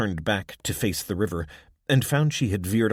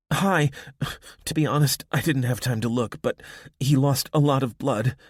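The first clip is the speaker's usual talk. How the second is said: higher and louder, hurried answers; urgent girl; 6 semitones higher, 5 dB louder than usual. A narrator's reading. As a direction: answer quickly and anxiously. Abby answers Irma urgently.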